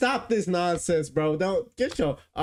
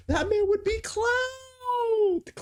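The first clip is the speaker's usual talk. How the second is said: disbelief; much slower than usual. Real, not acted. Outraged laugh at reviewers.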